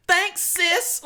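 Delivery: high, loud squeal of thanks; over-the-top high-pitched reply; gushing mock thanks. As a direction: shriek it with playful sass. Acted reply to the commenter.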